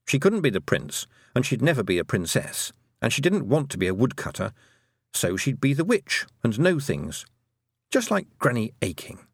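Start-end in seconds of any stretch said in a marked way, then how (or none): none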